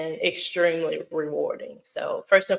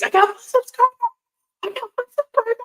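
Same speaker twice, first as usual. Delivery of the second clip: very high-pitched excited squeal; over-the-moon excitement; 6 dB louder, much faster than usual. Retold or imitated. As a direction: squeal it sky-high, reliving the thrill of telling your kids. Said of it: "I was so, so over the moon".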